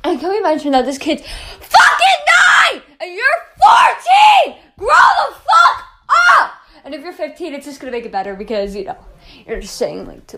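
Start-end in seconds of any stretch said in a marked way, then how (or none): 1.2-6.5 high, shouted outrage; angry scolding. Real, not acted. Ranter scolding a fourteen-year-old for threatening a kid, angry shout. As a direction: scold hard, stretching the last words.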